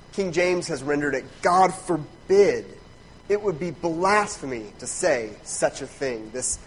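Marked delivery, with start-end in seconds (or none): 1.4-2.7 loud, slow GOD FORBID; emphatic refusal. Retold or imitated. Preacher quotes King James on Paul's answer, emphatic. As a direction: stretch GOD and FORBID with force. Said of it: "the most emphatic no".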